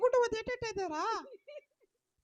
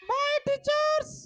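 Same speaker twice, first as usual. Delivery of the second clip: drawn-out, higher goodbye shout; calling out goodbye; 4 semitones higher, slower than usual. Acted. Child calling goodbye to the Sunday school teachers, slow shout. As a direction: stretch it out and call across the hall.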